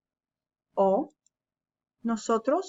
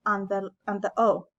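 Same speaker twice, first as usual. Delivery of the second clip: usual speaking voice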